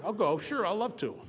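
high, over-willing acceptance; feigned eagerness. Retold or imitated. Feigned eagerness after private refusal.

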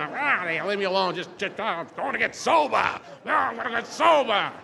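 loud, high shout at passing drivers; defiant yelling. Retold or imitated. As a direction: holler it back over the horns, building. Yelling back at honking passers-by.

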